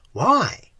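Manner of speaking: a high, startled why; a quick surprised question. A narrator's reading. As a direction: react in surprise. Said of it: "he asked in surprise".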